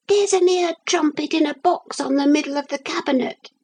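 high, quick little squeak; teeny tiny voice. A narrator's reading. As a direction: speak fast as a tiny figure. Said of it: "he could just hear the teeny".

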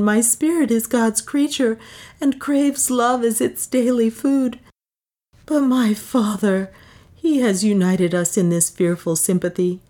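high, anguished plea of innocence; heartfelt, high-pitched lament; distraught pleading. A narrator's reading. Beatrice desperately pleads her innocence.

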